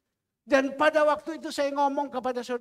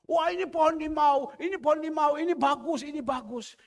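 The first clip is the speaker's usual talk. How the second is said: louder, delighted show-off; proud boasting; 5 semitones higher, 4 dB louder than usual. Retold or imitated. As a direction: brag about the lime tree. Wife proudly shows lime tree. (istri gembala memamerkan pohon limau dengan bangga)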